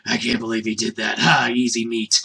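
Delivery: lightly voiced smug monster; sneering pterodactyl. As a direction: drop low and mock the fool. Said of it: "pterodactyl thing is like".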